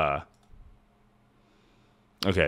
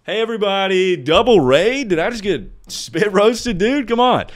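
higher, louder hype welcome; excited greeting; 10 semitones higher, 4 dB louder than usual. Real, not acted. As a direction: greet new viewers with hype. Greeting a double raid.

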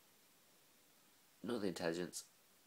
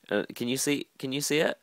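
excited; 7 semitones higher, 12 dB louder than usual. Real, not acted. Excited showing paintings to camera.

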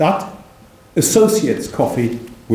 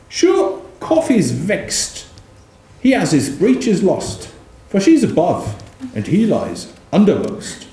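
poem reading; 5 semitones higher than usual. Acted. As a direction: roll out the insult like a pamphleteer. Reads poem couplets.

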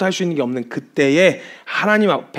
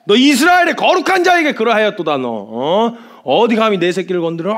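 loud outraged shout, starting high; stern rebuke; 6 semitones higher, 6 dB louder than usual. Acted. Preacher voices God rebuking the mocker of Israel, furious. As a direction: explode in acted divine anger. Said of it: "주님이 엄히 경고를 하시는데".

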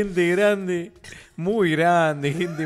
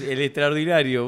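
ordinary talk, nothing marked

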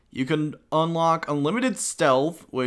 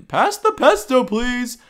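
silly high voice; 9 semitones higher, 7 dB louder than usual. Pretend. Voicing Italian jawbreaker slogan.

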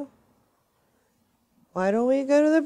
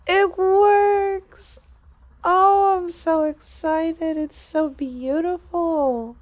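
excited; 6 semitones higher, slower than usual. Real, not acted. Engine starts, thrilled.

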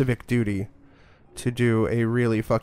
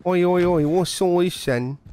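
mock British accent; 6 semitones higher than usual. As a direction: put on a silly British accent. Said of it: "a mock British accent".

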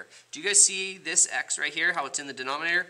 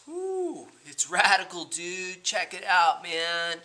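surfer dude; 5 semitones higher, slower than usual. Acted. Surfer pun on radicals.

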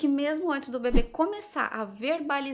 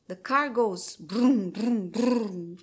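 slow, louder sing-song "brum, brum"; playful car noises; 5 dB louder, much slower than usual. Shown as a demonstration. Mother playing cars with toddler, playful sing-song. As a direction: make the car sound fun. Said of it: "Você colocou num outro contexto".

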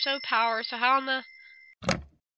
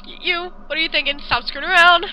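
higher, louder frightened protest; alarmed; 5 semitones higher, 6 dB louder than usual. Acted. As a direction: push her off in panic. Ren alarmed by Yuta.

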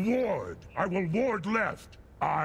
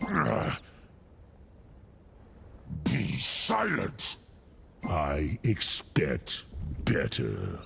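deep, booming giant lord of fire; low rumbling Jotun voice with a gloating laugh; menacing fire giant; 9 semitones lower, slower than usual. Acted. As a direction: loom over the hero and threaten doom. Voice lines of fire giant Surtur.

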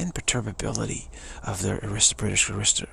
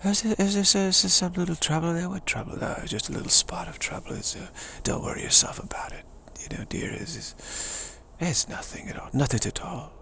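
high, unbothered upper-class British voice; British aristocrat; 7 semitones higher than usual. Acted. Aristocrat reassuring a dear about trouble, airily unbothered. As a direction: play an aristocrat airily dismissing any bother. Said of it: "so the aristocracy".

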